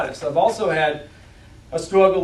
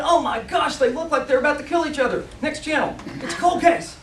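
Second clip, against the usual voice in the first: much higher, fast, running TV commentary; excited channel surfing; 9 semitones higher than usual. Pretend. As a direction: flip channels with rising alarm. Pretends to channel surf.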